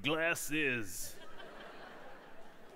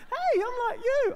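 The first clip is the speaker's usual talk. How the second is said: squeaky high pleading voice; a silly high-pitched plea to be one of them; high mock self; 6 dB louder than usual. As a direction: pitch it way up, eager to belong. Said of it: "explain that you used to be once a chav".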